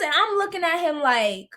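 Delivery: usual speaking voice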